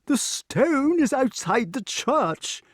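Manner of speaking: very high, slow insistence; King Pellinore. A narrator's reading. Pellinore explains the stone, high and exasperated. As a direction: spell it out in a high voice. Pellinore corrects his listeners.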